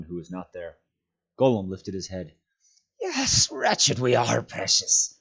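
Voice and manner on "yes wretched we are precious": slow, high creature whine; self-pitying creature whimper; whining. A narrator's reading. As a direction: draw out a wretched, pitiful whine. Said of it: "he whined".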